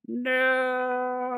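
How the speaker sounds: a high, stretched-out wail; anguished cry; terrified wail. A narrator's reading. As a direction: wail in horror at the body. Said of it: "I let out a terrified wail".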